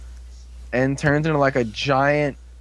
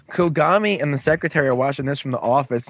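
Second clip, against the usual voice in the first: ordinary talk, nothing marked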